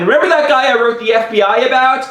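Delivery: high, urgent question; frantic, high-pitched appeal; panicked explaining. Retold or imitated. Panicked retelling to his woken mom.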